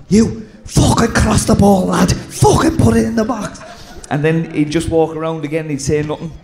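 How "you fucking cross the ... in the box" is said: higher, raised voice ranting at a player; hammed-up dressing-room rant; mimicking Cookie's rant. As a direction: bark the blame like an angry old teammate. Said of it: "he'd go to Keano then".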